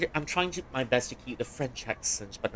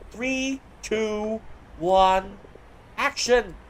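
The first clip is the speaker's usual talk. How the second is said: calling action; 9 semitones higher, 5 dB louder, much slower than usual. Real, not acted. Director counting the actors in, calling out loud. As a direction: count down loud and high, then shout action.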